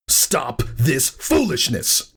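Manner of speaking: high, slow furious command; raging. A narrator's reading. Thunderhead to his sister, slow raging command. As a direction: drag it out in fury. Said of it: "he raged".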